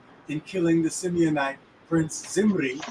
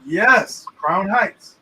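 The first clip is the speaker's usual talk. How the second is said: ordinary talk, nothing marked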